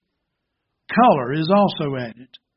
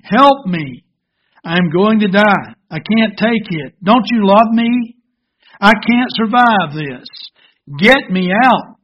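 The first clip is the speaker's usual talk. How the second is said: loud, raised, desperate pleading; screaming; 4 semitones higher, 7 dB louder than usual. Acted. Teacup in the hot furnace begging the potter, acted screams. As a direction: cry for rescue, loud and drawn out at the end. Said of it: "I began screaming".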